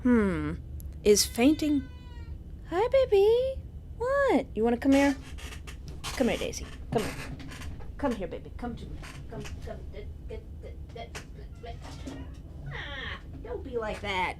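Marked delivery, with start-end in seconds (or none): 2.7-5.2 high-pitched pet talk. Real, not acted. Greeting the cat, Daisy. 6.2-14.3 quiet, fading coax to a pet; coaxing cat, softer and softer. Real, not acted.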